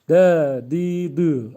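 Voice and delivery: "De, di, dü" called out loud, each syllable apart; letter vowels with articulation. Shown as a demonstration. Teacher calls out the vowelled letter for students. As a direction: sound out "De, di, dü" crisply and loud. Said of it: "Özellikle mahreçli olarak okuyorum".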